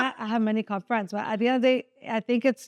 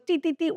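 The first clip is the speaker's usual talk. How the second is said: higher mock alarm beeps; imitated car beeps; 6 semitones higher than usual. Imitating her car's warning beeps, higher. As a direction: beep like a sensor. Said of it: "It goes".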